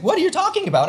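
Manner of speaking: high-pitched baffled protest; incredulous. Retold or imitated. Answering boss's demand to propose, incredulous. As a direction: throw it back in disbelief. His baffled reply to boss.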